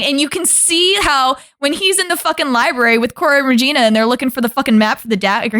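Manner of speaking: ordinary talk, nothing marked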